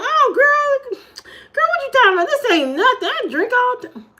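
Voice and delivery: high-pitched breezy brush-off; telling her friend she is fine, high mock bravado. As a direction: toss it off high and carefree. Retold self bragging to friend.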